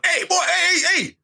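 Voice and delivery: high-pitched pretend confrontation; hammed-up, laughing challenge, pitched right up; mock aggressive calling. Man showing what would count as a threat, mock aggressive. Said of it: "'Cause if I would've gone".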